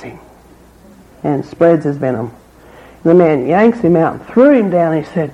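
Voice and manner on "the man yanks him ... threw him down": normal talking voice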